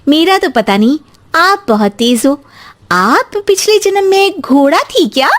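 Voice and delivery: cheeky acted comeback; teasing comeback. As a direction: toss the taunt back, climbing high at the end. Younger sister-in-law teases back.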